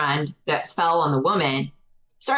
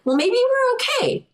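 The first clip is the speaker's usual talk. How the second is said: high hopeful quote. Retold or imitated. Hopeful quote, much higher.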